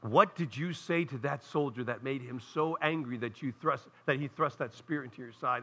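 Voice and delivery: low, hushed retelling of the preacher; a hint of the old Southern preacher's wonder; softly quoting old preacher. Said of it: "Southern Baptist preacher".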